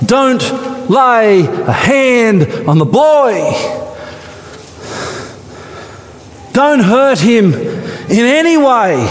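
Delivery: high, slow commanding call; slow and solemn, stop him; angel's command. Acted. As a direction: angel halting Abraham. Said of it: "the angel of the Lord called".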